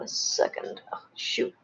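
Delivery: under-breath mutter; whispered curse. Real, not acted. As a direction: whisper it to yourself. Quiet muttered frustration.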